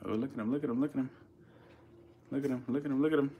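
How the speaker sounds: quiet, hushed voice. Real, not acted. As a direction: murmur it so you don't startle him. Hushed while watching the centipede.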